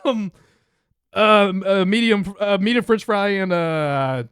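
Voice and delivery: loud, high, hesitant ordering; nervous customer. Acted. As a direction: stumble through the order.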